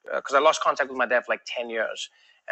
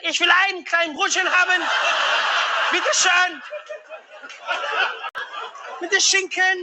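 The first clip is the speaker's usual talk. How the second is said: Hitler; 13 semitones higher than usual. Acted. Comedian orders bread in Germany, sounding like Hitler. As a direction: order food as if addressing a rally. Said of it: "a little bit like Hitler".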